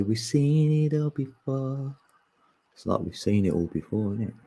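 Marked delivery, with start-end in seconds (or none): 0.0-2.0 higher, slow knowing line; drawn-out been-there voice; mock wise voice. Shown as a demonstration. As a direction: say it like a weary old-timer. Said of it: "Then you can say".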